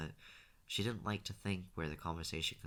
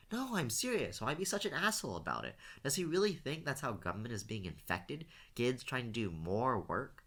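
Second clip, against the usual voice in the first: high-pitched, insistent arguing; indignant protest; 6 semitones higher than usual. A narrator's reading. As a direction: argue back as if refusing to be silenced. Derek argues on, ignoring warning.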